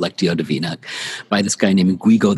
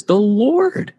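"Lord" sung up high and held; stress on Lord; 12 semitones higher than usual. Shown as a demonstration. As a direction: Lord lifted high and drawn out on a rise. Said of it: "it's saying something specific about who your shepherd is".

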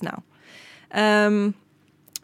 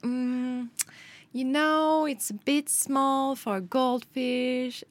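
higher, slow condescending shop girl, lightly played; imitating clerk; 5 semitones higher, much slower than usual. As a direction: talk down to the customer like a smug clerk. Snooty shop clerk quoted.

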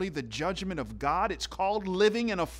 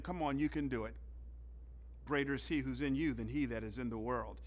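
much softer, low encouraging murmur; soft encouragement; 4 semitones lower, 12 dB quieter, faster than usual. Retold or imitated. The Holy Spirit as spotter urging the struggling believer, soft and encouraging. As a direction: coax them gently through the last rep.

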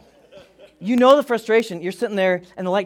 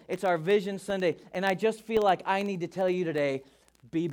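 ordinary talk, nothing marked

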